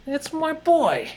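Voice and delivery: lightly hammed movie-guy voice; movie character impression. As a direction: stretch it out in a goofy high voice. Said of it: "that impression I was doing".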